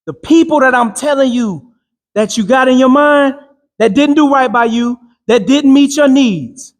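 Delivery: fervent preacher cadence; rousing motivational preaching. Acted. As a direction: drive it home like a sermon to the crowd. Preaching to a crowd.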